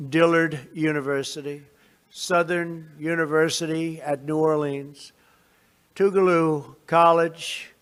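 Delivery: measured, formal address to a crowd; podium speech. Real, not acted. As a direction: deliver it like prepared remarks.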